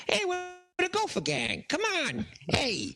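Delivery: put-on high, cocky street-kid voice, far above his own; newsie kid voice. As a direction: play a scrappy old-time newsboy calling out. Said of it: "they all look like, uh, newsies".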